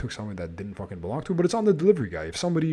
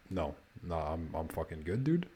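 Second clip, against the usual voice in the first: quiet refusal; 6 semitones lower, 9 dB quieter than usual. Retold or imitated. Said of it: "I was like".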